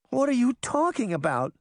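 high, fretful question; mildly frightened, high-pitched bewilderment; whimpering. A narrator's reading. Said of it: "Sabrina whimpered".

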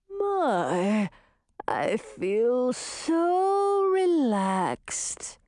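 long drawn-out words, very slow; blissfully relaxed monster. A narrator's reading. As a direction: luxuriate in every word, stretching it out. Drugged manticore waking from snake venom.

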